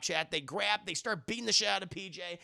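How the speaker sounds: usual speaking voice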